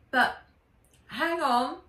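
put-on high, loud girl interrupting; quick girl stopping.

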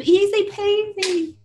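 high, slow singsong; breezy reassurance. Acted. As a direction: draw it out high as if it's nothing. Breezily makes light of a curse.

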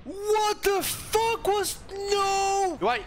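slow, high-pitched disbelief; dismayed outcry. Real, not acted. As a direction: stretch it out high in protest. Dismayed at game events.

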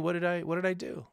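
normal talking voice

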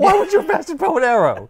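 high, loud, laughing disbelief; mock incredulous. Podcaster ribbing co-host playing Captain America, mock incredulous. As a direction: squawk the question through a laugh.

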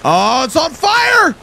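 slow, high alarmed shout; shocked shout. Real, not acted. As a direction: cry out in shock at the fire. Sees fire, shouts.

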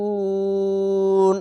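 very long রু in ইউনজারুন, closing on ন; three-alif stretch. Shown as a demonstration. Teacher stopping at the verse end, long-held recitation. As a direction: chant ইউনজারুন slowly, sustaining the last syllable evenly. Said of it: "তিন আলিফ টেনে পড়লাম".

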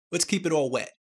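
ordinary delivery

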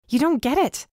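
yelled. A narrator's reading. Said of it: "I yelled".

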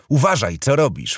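high, loud irritated snap; angry warning; sharp snap. Acted. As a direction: scold him for the spilled wine. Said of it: "fuknęła Sally".

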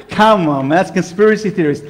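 ordinary delivery

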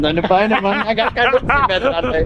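high, slow strained squeak; mock strained voice. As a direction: squeeze it out like hanging on. Mimicking friend hanging exhausted.

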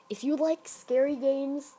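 normal talking voice

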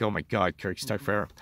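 quiet, exasperated mockery; mock exasperated viewer. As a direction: sigh it out like a bored viewer. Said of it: "You people are like".

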